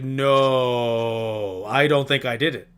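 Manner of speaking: loud, stretched-out "no" imitating him; hammed-up, booming protest; mock melodramatic denial. Host imitating how he says it, loud mock-dramatic denial. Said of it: "That's how he says".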